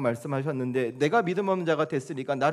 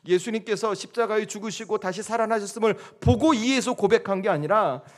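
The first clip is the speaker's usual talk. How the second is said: raised, higher-pitched sermon delivery; impassioned preaching; 8 semitones higher, 6 dB louder than usual. Acted. Pastor telling congregation of love behind the wounds, impassioned. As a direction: drive the message home with fervour.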